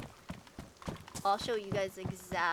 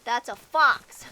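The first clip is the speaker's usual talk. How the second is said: excited; 2 semitones higher, 11 dB louder than usual. Retold or imitated. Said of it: "like, excited".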